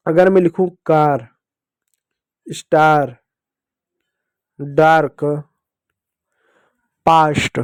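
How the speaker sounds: कार, स्टार, डार्क, पास्ट each drawn out with a long aa; slow word list, the a stretched to aa; reading example words. Shown as a demonstration. Teacher showing pupils the long aa sound in words. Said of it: "ए को यहाँ पर अब आपने आ की मात्रा पढ़ा".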